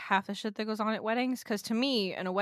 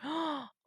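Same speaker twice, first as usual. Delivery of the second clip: shocked gasp; 6 semitones higher, 6 dB quieter than usual. Real, not acted. Shocked reaction to the veil story.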